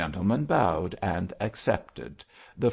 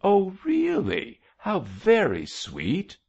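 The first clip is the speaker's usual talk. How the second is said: high gushing "Oh, really", then slower; admiring cry; 12 semitones higher than usual. A narrator's reading. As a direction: gush in a high voice, then savour it slowly. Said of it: "cried Daisy, casting an admiring look".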